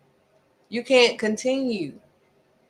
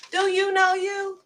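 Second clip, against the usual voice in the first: pitched way up and held there; pointed high question; 12 semitones higher than usual. Real, not acted. Preacher asking listeners if they know themselves, high and pointed. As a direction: ask it high, pressing the listener.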